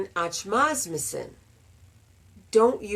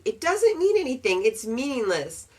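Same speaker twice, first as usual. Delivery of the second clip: ordinary talk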